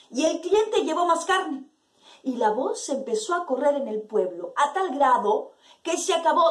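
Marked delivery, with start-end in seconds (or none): none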